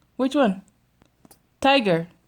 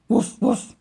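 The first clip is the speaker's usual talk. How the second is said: dog sound. Pretend.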